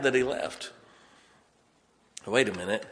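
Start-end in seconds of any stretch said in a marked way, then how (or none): none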